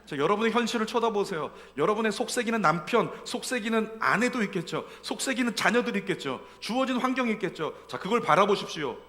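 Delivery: fervent preaching. Acted. Pastor urging the congregation, fervent preaching. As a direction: drive the point home to the pews.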